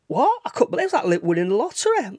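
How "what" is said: a sharp, much higher "What?" in disbelief; shocked. Retold or imitated. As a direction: blurt it out, stunned. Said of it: "I was that shocked".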